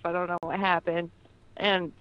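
ordinary delivery

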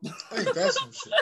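high, slow, squeaky laugh-talk; laughing disbelief. Real, not acted. Host laughing at the co-host's betting logic, squeaky and slow.

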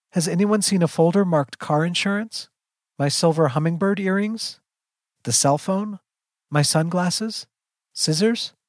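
high, rising questions; anxious searching mother. A narrator's reading. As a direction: call out for each lost thing, fretting. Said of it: "Her anxiety and frustration".

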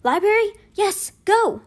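high, loud excited calls; urgent, high-pitched yell; shouting. A narrator's reading. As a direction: shout it out on the run. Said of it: "all shouting".

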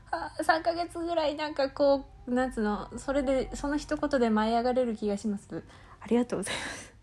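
softly spoken, overwhelmed thanks; quietly moved by praise; subdued gratitude. Real, not acted. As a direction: drop to a small, soft voice.

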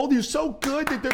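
high mocking parent voice, very high; mocking proud parent.

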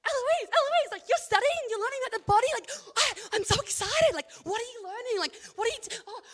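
the Holy Spirit gushing about your studies, overjoyed; excited Holy Spirit. Retold or imitated. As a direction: voice an overjoyed friend who can't contain it. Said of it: "the Holy Spirit is like".

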